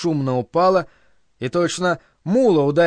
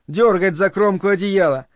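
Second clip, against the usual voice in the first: ordinary delivery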